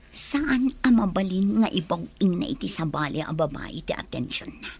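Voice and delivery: dropping to a sly hush; scheming aside. Acted. Rival muses alone, voice sinking to a sly hush. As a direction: plot it under your breath.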